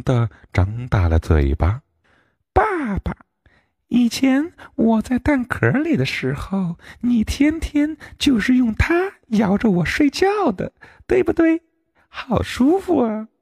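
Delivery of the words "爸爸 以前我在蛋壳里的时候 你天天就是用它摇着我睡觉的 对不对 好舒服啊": very high little duckling voice; a cheerful chick remembering the egg; 小鸭子开心地说; happy duckling. A narrator's reading. Duckling talks to the fox.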